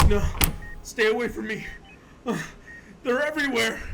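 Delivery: panicked. Acted. Hysterical patient begging the staff to keep away. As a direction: push them away in terror.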